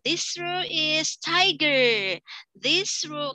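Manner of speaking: teacher assigning groups. Shown as a demonstration. Teacher naming the next row's animal, slow and bright. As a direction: announce the team playfully. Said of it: "classroom instructions demo".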